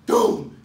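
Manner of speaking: loud booming door slam imitated. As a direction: slam it out like a heavy cell door. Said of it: "one sound that always made me jump".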